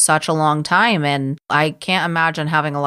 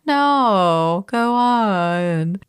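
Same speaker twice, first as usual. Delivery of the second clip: higher, drawn-out insincere coaxing; unconvincing reassurance; 4 semitones higher, much slower than usual. Retold or imitated. Producers urging her to continue, unconvincing reassurance. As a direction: coax high with obvious fake sympathy. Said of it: "in the most unconvincing no".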